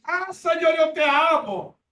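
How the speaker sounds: loud, high, slow ecstatic wail to the Lord; mock the over-emotional worshipper; overdone emotional cry. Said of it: "Estás cargado de emociones".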